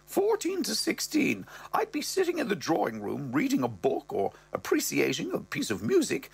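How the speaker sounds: high put-on mother voice; mother's voice. Mother recalling her son's teens, high falsetto. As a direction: tell it as the mum, high. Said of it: "she goes".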